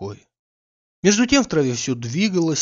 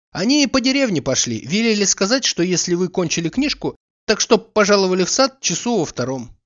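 put-on higher, lively girl's voice; lightly voiced young maid; 6 semitones higher, 3 dB louder than usual. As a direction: play the quick little maid.

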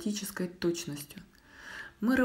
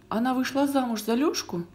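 higher, eager astonished question; surprised question; 6 semitones higher, 4 dB louder than usual. A narrator's reading. Boy asks grandmother about Valya's marriage, surprised question. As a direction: ask with rising astonishment.

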